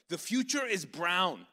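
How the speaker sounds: emphatic slow proclamation; bold declaration. Retold or imitated. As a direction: announce it like a prophecy. Bold claim before a crowd.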